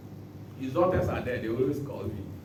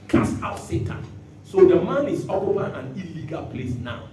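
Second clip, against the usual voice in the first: ordinary delivery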